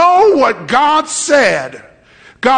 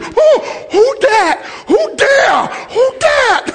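high, drawn-out startled cry; mock alarm, pitched way up; startled sleeper; 12 semitones higher, slower than usual. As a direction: blurt it out as if jolted awake.

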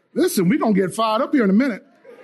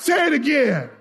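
rallying call; 8 semitones higher than usual. Real, not acted. Preacher drilling the congregation.

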